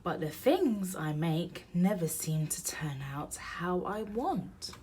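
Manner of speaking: plain reading voice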